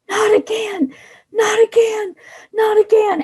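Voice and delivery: high, sobbing plea; crying. Acted. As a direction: sob the words out, pleading. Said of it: "she is saying".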